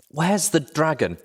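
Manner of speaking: breathless, high, slow gasp of a question; panted. Acted. Injured pony gasps to her friend, panting. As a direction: push it out between pants. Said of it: "I panted".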